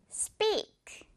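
whispered "Speak" with a tense p. Shown as a demonstration. Teacher whispers the word for the students to repeat, hushed. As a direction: breathe "Speak" with no voice. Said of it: "듣고 따라해주세요".

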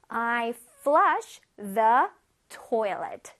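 very slow, word-by-word teacher's model; slow modelling. Shown as a demonstration. Teacher reads an example for children, very slowly. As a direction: stretch each word out clearly for learners.

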